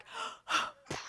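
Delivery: breathy startled gasp; Lucifer's fall told with a gasp; sharp gasp. Acted. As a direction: gasp as if falling. Lucifer falling from heaven.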